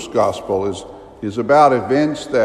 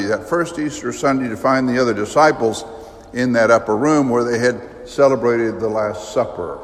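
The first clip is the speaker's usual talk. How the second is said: normal talking voice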